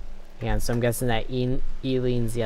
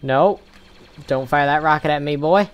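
loud, higher angry yell; raging gamer; 4 semitones higher, 6 dB louder than usual. Real, not acted. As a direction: yell at the teammate. Shot by own team.

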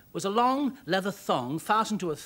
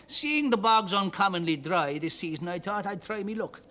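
Irish man. Acted. Rescued Irishman thanking father and daughter, grateful brogue. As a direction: thank them in a thick Irish lilt.

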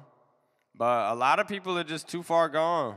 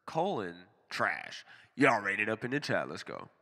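normal talking voice

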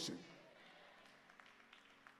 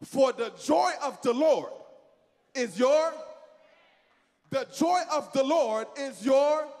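normal talking voice

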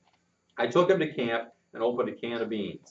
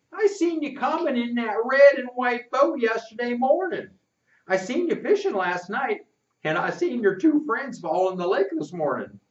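put-on high boyish country voice, eager; a sly kid boasting what he saw; country boy Rufus; 12 semitones higher than usual. Rufus tells the narrator what he saw, boyish and high.

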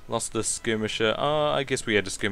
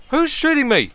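alarmed shout; 7 dB louder than usual. Real, not acted. Shot at in battle.

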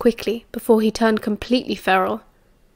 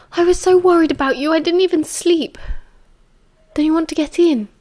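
higher, plaintive confession of worry; worried Sirius; 6 semitones higher than usual. Acted. Sirius waited up for Remus all night, worried. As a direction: pitch it up, anxious and affectionate.

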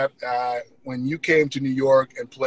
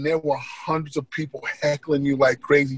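ordinary talk, nothing marked

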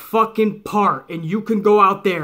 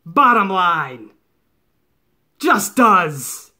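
normal talking voice

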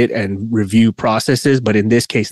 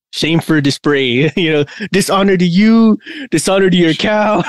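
higher, hammed-up declaration of disgrace; mock shaming; 6 semitones higher than usual. Co-host apologizing for skipped weeks, mock shaming. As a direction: playfully heap shame on yourself, pitched up.

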